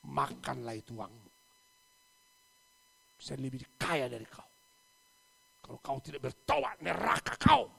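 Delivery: loud rebuke; scolding shout. Retold or imitated. Preacher shouting at rich man to repent.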